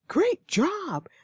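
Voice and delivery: high, bright praise leaping way up; excited, encouraging. Shown as a demonstration. Teacher praising a student, high and excited. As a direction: cheer them on with real delight. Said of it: "You take that positively".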